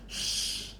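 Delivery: very soft shh to hush someone; shushing. Shown as a demonstration. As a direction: hush a little sibling. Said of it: "Like you're saying quiet".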